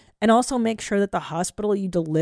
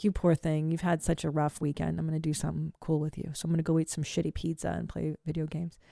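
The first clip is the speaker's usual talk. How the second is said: put-on softer, gentle mom voice to her boy; tender to son; 3 semitones lower, 8 dB quieter than usual. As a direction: comfort a tired child softly. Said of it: "I was like".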